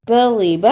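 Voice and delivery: کذب with the final ب bounced out loud; qalqala on ba. Shown as a demonstration. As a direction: end کذب with an echoing ب. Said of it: "قلقلہ بھی کرنا ہے با پر". (کذب میں با پر قلقلہ)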